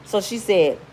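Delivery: ordinary delivery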